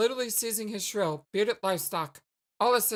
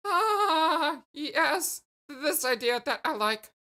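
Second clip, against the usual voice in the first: excited; 6 semitones higher, much slower than usual. Acted. Alien loves the idea.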